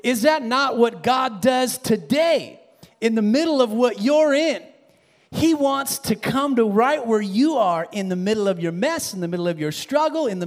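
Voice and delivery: swelling sermon climax; preaching at full force. Acted. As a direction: preach it out to the whole church.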